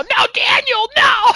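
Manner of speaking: high, loud mock scream; imitated scream. Retelling a favorite film scene to friends, imitated scream. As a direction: cry out like the screaming movie character. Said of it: "as he's screaming".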